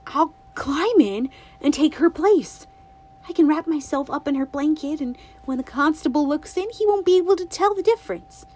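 put-on a bright little girl asking her granny; girlish and eager, lightly voiced; Winnie's voice. A narrator's reading.

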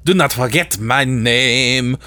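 slow, hammed-up French accent. Javert if Les Mis went French, hammed-up French accent. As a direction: draw it out in a mock French accent. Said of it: "French accents?".

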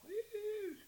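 high-pitched squeal of disgust, imitating her; girly disgust. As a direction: squeak out a mock grossed-out "ew". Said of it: "and she's like".

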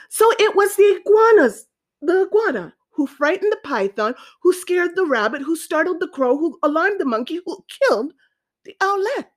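lightly voiced king, animated and singsong; king recounting the blame. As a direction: build the list for the council.

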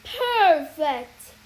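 loud, triumphant boom. Acted. Sorceress gloating over her shrunken captive, booming. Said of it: "her voice boomed".

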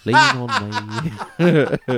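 put-on high, drawn-out sung Irish accent; hammed-up Irish singing, way up high. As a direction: sing it slowly in a mock Irish lilt. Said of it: "do it in an Irish accent".

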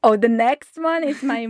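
ordinary talk

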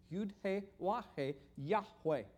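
"Yud He Wah He" in separate parts, then "Yahweh" as one name; sounding out. Shown as a demonstration. Teacher showing how the letters become the name, sounded-out demonstration. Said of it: "You can kinda hear that".

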